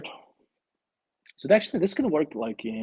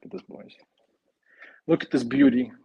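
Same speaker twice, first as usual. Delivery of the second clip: ordinary talk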